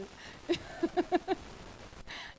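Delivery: airy run of laughter; amused chuckling. Real, not acted. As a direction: chuckle on for a couple of seconds. Laughs over friend's amused reply.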